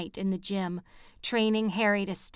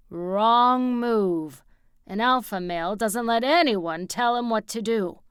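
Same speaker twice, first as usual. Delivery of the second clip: alpha male; 6 dB louder than usual. A narrator's reading. Jonas lecturing, alpha male voice.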